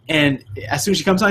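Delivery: ordinary delivery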